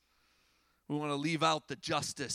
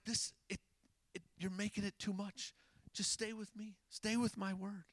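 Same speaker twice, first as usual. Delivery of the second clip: hushed, slightly raised voice; soft plea; 4 semitones higher, 8 dB quieter than usual. Retold or imitated. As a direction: plead softly, as if imitating a gentle appeal. Voices Jesus pleading with his people.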